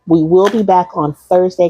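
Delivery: ordinary talk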